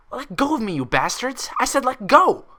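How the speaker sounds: high, loud furious screech. A narrator's reading. Mare dragged off by orderlies, screeching at them to let go. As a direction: fight the orderlies with every word. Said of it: "a pale green mare screeched".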